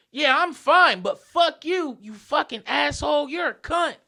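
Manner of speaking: high, loud abusive outburst; retold scream. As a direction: imitate an irate stranger yelling insults. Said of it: "He scream".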